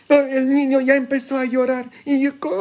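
high-pitched mock alarm; put-on high worried voice; mimicked fretting parent. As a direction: imitate a parent fussing over a crying child. Mimicking parents fretting over crying child.

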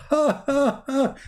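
high, spaced-out "ha, ha, ha" cackle; mock devilish laugh. Comic telling of Shaitan jumping into a yawner's mouth, mock laugh. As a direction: imitate a gloating devil's laugh. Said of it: "he laugh like".

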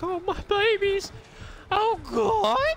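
very high, loud mock wail; imitating an overheard voice. Gamer mimicking the voice he just heard, high-pitched imitation. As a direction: squeal it out, mimicking him loudly. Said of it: "He's like".

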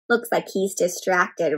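plain reading voice

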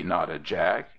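usual speaking voice

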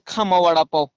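normal talking voice

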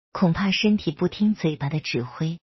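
usual speaking voice

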